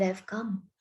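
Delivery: usual speaking voice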